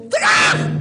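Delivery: very high, loud imitated crash; mock crash noise. Rabbi acting out the moment of the cut with a loud crash noise.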